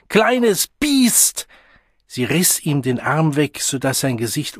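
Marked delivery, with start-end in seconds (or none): 0.1-1.5 high, loud angry insult; furious shout. A narrator's reading. Mother about to strike her child, shouting in fury. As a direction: spit out the insult at full voice.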